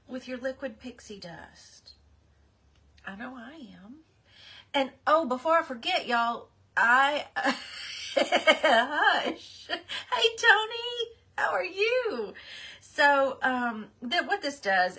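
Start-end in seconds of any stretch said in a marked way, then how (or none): 0.1-4.0 whisper. Real, not acted. Hyping a craft product. 6.8-12.3 laughing greeting. Real, not acted. Laughing while greeting a viewer.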